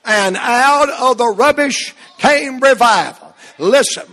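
ordinary delivery

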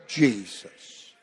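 low, soft, lingering Jesus; hushed reverent name. Real, not acted. Preacher savoring the name before his congregation, hushed and reverent. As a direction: breathe the name Jesus softly in awe. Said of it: "I just like the way that sounds".

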